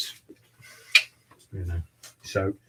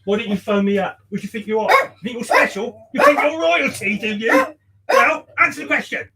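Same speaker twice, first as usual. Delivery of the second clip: hammed-up scolding, higher and louder; mock telling-off; 8 semitones higher, 8 dB louder than usual. Barber mock-scolds late client for webcam, hammed-up angry shout. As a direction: put on a fake rant at the late client. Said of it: "That's why I shouted at you".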